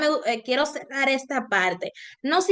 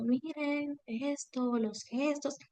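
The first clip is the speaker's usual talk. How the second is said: very soft, unsure mumble; timid low volume; 11 dB quieter, much slower than usual. Shown as a demonstration. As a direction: say it softly and unsure, barely audible. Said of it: "me voy a ver insegura". (voz muy baja e insegura)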